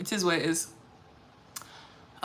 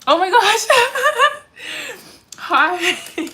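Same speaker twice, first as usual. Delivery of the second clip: high, loud squealing laugh and hello; delighted squeal; 10 dB louder, much slower than usual. Real, not acted. Streamer spots a favourite viewer in chat, squealing delight. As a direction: squeal with joy at a friend.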